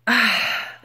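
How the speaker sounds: long, weary exhale; exasperated sigh. Retold or imitated. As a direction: sigh out your disappointment. Exasperated by young manager.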